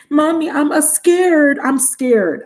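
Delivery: mock frightened toddler whine; little child voice. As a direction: mimic a small son calling for mommy. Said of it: "That's what my son used to say".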